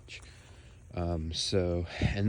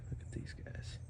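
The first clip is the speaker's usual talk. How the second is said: hushed whisper; 13 dB quieter than usual. Real, not acted. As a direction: breathe it out in a whisper. Dismay at fried oleanders.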